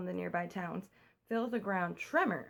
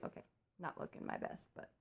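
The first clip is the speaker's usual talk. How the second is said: whispered aside; 12 dB quieter than usual. Real, not acted. Whispered aside, fiance sleeping.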